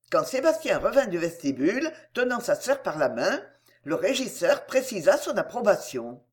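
ordinary delivery

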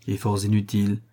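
ordinary delivery, nothing marked

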